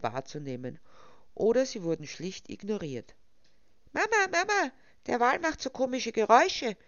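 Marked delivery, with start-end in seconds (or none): 3.9-6.8 put-on high, loud child voice; panicked child crying out. A narrator's reading. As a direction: shriek for mum like a frightened child. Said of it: "schrie plötzlich ein Kind auf".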